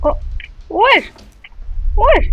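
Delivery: sharp high shout of wesh; loud startled call. Real, not acted. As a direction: yell it to get her attention. Calling out to game character.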